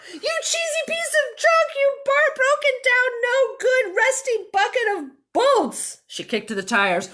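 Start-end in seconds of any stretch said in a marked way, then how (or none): 0.1-6.0 shouted insults. Acted. Minnie yells at the broken tractor, high and loud. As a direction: belt it out like a furious farmer. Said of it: "Minnie shouted".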